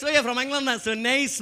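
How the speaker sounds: put-on polite Scottish welcome in an Edinburgh accent; friendly Edinburgh Scottish impression; nice Edinburgh local. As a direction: greet them pleasantly as an Edinburgh Scot. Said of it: "bit less friendly".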